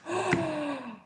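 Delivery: long, high sucked-in gasp; astonished gasp. Real, not acted. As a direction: gasp sharply in amazement. Reaction to next card.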